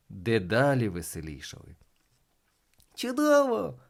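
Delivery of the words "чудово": high-pitched gleeful cry; delighted exclamation. A narrator's reading. Children cheering the failed shots, high gleeful exclamation. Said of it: "вигукували вони".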